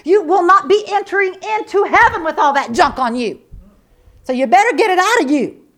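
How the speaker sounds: loud, high, driving warning; fiery preaching. Acted. Preacher warning the church about unforgiveness, loud and fiery. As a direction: hammer it home from the pulpit.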